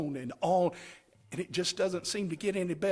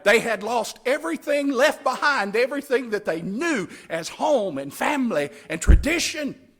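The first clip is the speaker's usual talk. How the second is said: preaching at full force; 5 semitones higher, 7 dB louder than usual. Acted.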